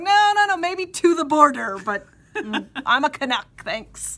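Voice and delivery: high, loud insistent denial; mock protest. Correcting the Southern grandfather, a loud mock-indignant protest. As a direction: push back playfully, proud Canuck. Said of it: "And I'm like".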